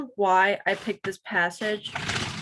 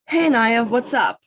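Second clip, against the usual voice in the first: louder, upbeat acted hello; lightly voiced, breezy greeting; 3 semitones higher, 7 dB louder than usual. A narrator's reading. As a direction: call out a casual hey to a friend. Sean pops out to greet Anaya.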